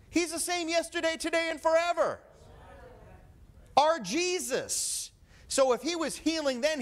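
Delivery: high, loud, ringing preacher's cry; full-force preaching. Acted. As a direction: lift the whole church with it.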